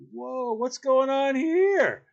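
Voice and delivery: high, slow, wide-eyed whoa; startled whoa. Retold or imitated. Newcomer walks in on chaos, stunned and slow. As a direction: drag out the shocked question.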